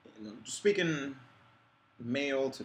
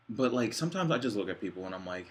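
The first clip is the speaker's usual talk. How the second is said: usual speaking voice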